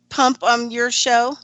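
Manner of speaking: ordinary talk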